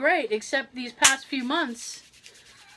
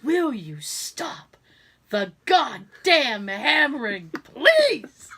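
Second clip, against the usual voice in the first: exasperated movie quote; 3 dB louder, much slower than usual. Retold or imitated. Quoting Scrooge at snoring husband, exasperated outburst. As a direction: stretch it out, then explode on the last word. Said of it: "that is how I said it last night".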